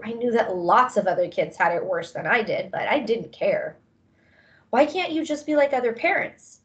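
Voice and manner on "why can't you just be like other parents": higher-pitched, mildly exasperated demand. A narrator's reading. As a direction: press them for an answer, with restraint. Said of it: "I demanded".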